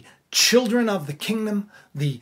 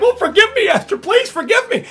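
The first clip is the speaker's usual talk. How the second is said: very high, louder whiny plea; begging for mercy; 12 semitones higher, 7 dB louder than usual. Retold or imitated. Haman begging Esther for his life, in a high desperate whine. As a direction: grovel for your life, half laughing. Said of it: "pleading for his life".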